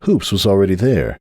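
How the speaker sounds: plain reading voice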